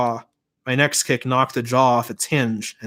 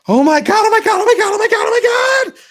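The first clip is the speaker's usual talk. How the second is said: very high, loud, frantic repetition; panicked screaming; 8 dB louder, faster than usual. A narrator's reading. Bryce after stapling his thumb, frantic panicked scream.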